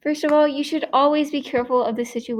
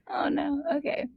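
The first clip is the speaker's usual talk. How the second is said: usual speaking voice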